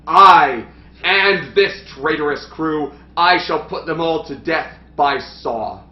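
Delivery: overdone Shakespearean king; hammy stage actor. Acted. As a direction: ham up the pompous king. S1 plays the king.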